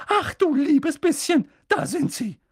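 mock-horrified aunt; horrified cry. A narrator's reading. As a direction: gasp it out in alarm. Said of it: "rief Tante Petunia hellauf entsetzt".

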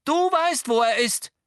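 very high, loud demand; urgent shout. A narrator's reading. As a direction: press the accusation, voice leaping up. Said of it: "rief Harry".

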